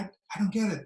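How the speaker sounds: ordinary delivery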